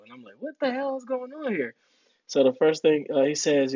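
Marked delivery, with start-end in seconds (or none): 0.4-1.7 much higher, baffled; totally lost, asking the chiefs; mock bewildered. New arrival rushed by chiefs, high and bewildered.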